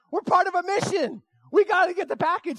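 high, frantic retold plea; urgent protest. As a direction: insist the mission comes first. Urgent thought despite sick wife.

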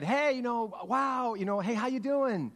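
high, loud, surprised hello; cheery acted greeting. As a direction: greet an old acquaintance brightly, as if imitating. Mom greets acquaintance in store.

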